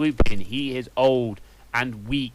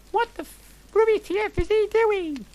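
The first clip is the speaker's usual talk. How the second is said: put-on squeaky high goth girl, breathless gush; shrill up in the head like a teen diva; high valley girl voice; 4 dB louder than usual. Narrator gushing to friends in a high valley girl voice. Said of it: "I asked".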